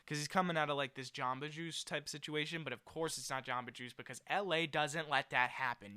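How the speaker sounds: normal talking voice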